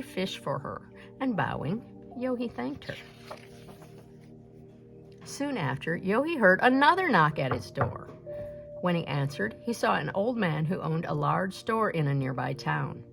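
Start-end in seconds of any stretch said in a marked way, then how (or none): none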